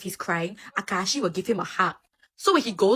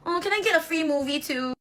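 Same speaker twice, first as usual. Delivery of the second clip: whiny ask; 9 semitones higher than usual. Retold or imitated. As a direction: push your luck sweetly. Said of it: "He will be like".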